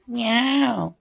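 meow. Pretend. Meows at cat.

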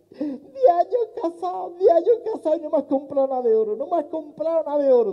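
high, wailing hammed-up sob; crying wife wailing about no gold; mock crying wife. Crying wife complains to husband.

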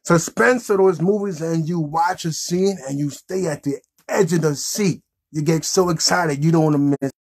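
ordinary delivery

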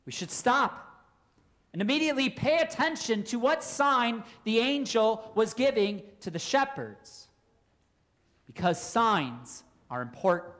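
ordinary delivery

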